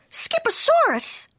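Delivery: very high, slow questioning echo; incredulous declaring. A narrator's reading. Mama coming into the room, declaring incredulously. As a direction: repeat it high in disbelief. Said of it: "declared Mama Junebug Jones".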